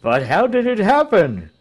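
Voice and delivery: high, loud, urgent question; astonished demand. A narrator's reading. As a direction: press for the details in shock. Crowd demands details of death.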